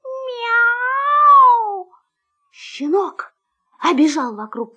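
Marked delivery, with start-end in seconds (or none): 0.0-1.9 high, drawn-out meow; big cat call; loud cat meow. A narrator's reading. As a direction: meow long and high, swelling louder. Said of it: "кто-то громко сказал".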